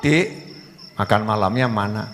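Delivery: low, soft request; acted mild-mannered husband; gentle husband. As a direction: ask meekly, pitched well down. Acted husband gently asks for dinner.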